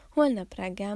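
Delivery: ordinary delivery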